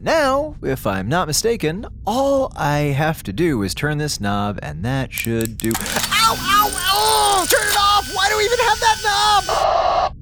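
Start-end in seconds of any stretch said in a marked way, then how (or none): 5.7-10.2 pained yelling. Acted. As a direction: yelp and shout through the pain. Knob hurts him, yelling.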